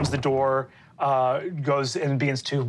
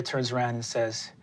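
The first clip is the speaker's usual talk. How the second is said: ordinary delivery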